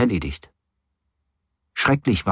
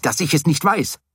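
restrained narrated yell; 8 semitones higher than usual. A narrator's reading. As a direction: protest with a contained shout. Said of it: "schrie ich".

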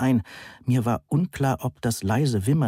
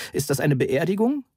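higher-pitched, mildly alarmed question; startled; 7 semitones higher than usual. A narrator's reading. Visitor worried he has intruded on a funeral, startled. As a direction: ask in fright, rising at the end. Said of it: "fragte ich erschrocken".

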